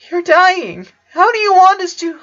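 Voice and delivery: high, tearful plea; tearful pleading. A narrator's reading. Sister pleading with her dying brother, tearful and high. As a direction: beg him through tears, voice high.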